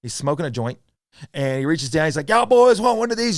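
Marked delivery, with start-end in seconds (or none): 2.3-3.3 put-on high, loud good-old-boy offer; raised, hollering Southern impression; imitated driver. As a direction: mimic a rowdy stranger handing out beers. Imitates stoned driver offering beer.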